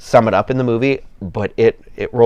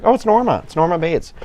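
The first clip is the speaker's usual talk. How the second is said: excited recognition; 7 semitones higher than usual. Retold or imitated. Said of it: "So I'm always like".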